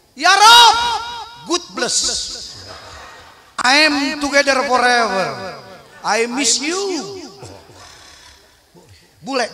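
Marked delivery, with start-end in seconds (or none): 0.1-7.2 hammed-up foreign praying; mock foreigner prayer. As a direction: parody of a foreign pilgrim's prayer. English-speaking foreigner praying mockingly.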